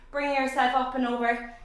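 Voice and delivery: ordinary talk, nothing marked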